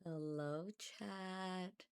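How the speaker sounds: ordinary delivery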